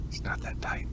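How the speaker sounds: breathy whispered punchline; hushed conspiratorial whisper; whispered aside. Acted. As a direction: whisper it like a dirty secret. Dirty joke aside about butthole.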